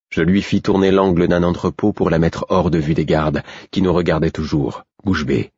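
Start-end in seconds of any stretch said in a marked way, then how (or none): none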